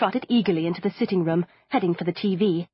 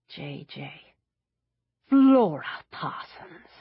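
dreamy musing; slower than usual. A narrator's reading.

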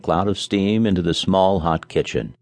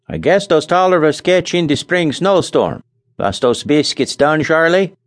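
put-on Scandinavian accent; 9 semitones higher, 3 dB louder than usual. A narrator's reading. Nels voiced, accent.

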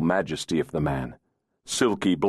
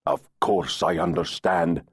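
growled. Acted. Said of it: "Searson growled".

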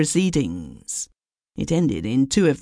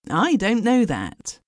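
higher, drawn-out sharp objection; sharp retort; 8 semitones higher than usual. A narrator's reading. Hurst answering the doubting commercial, mildly sharp. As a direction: push back a little sharply, pitched up. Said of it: "said Hurst sharply".

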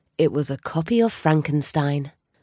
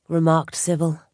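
usual speaking voice

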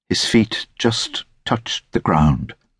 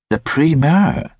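higher-pitched, querying echo; lightly voiced querying lady; 9 semitones higher than usual. A narrator's reading. Lady querying Hackett's fancy word, in a higher voice.

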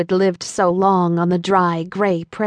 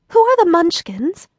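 put-on higher, curious young girl voice; lightly voiced Dorothy; young girl asking; 9 semitones higher, 3 dB louder than usual. A narrator's reading. Dorothy asking the old woman, high and curious. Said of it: "inquired Dorothy".

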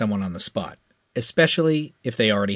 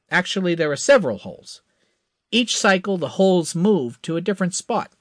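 higher, confident hotshot; eager young pilot; 8 semitones higher than usual. A narrator's reading. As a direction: promise it with cocky eagerness. Crash briefing, higher voice.

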